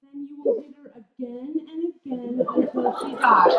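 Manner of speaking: laughing through. Retold or imitated. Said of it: "calm as the surface of a pond".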